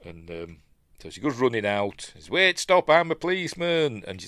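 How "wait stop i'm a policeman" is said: high, louder imitation of a policeman calling; mock urgent shout. Policeman to fleeing woman, mock urgent shout. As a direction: call out to halt someone, hammed-up.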